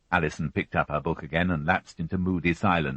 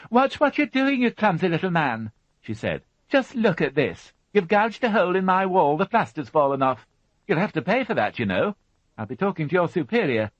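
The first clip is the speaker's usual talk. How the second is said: high, loud indignant telling-off; angry scolding; 13 semitones higher, 4 dB louder than usual. Acted. Mother scolding the removal man, high loud indignation. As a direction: scold the clumsy workman shrilly.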